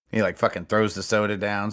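usual speaking voice

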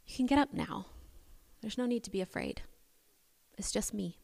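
softly voiced, as if waking sleepers; tender, hushed comfort; gentle reassurance. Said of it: "touches them gently, as if waking them from sleep".

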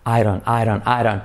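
"I don't" said like "airon"; soft tapped R. Shown as a demonstration. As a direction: turn the D of "don't" into a light R. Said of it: "se convierte en una R suave".

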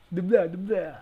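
lightly voiced vampire blah; a hint of hammy vampire; Dracula. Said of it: "It's fucking Dracula".

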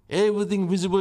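ordinary talk

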